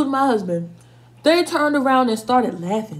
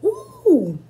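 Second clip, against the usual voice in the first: delighted ooh; 12 semitones higher, 6 dB louder than usual. Real, not acted. Tasting the food, first bite.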